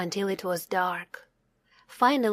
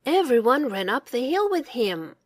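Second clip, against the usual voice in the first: plain reading voice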